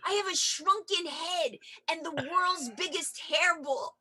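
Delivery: high, slow, proud announcement; proud reveal. Retold or imitated. Telling boyfriend what's in the trunk, proudly. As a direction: savor the weird reveal. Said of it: "so I said".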